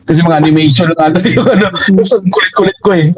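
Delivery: normal talking voice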